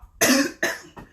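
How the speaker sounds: hard choking cough; coughing fit. Real, not acted. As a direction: cough hard, something stuck. Live stream, choking on drink.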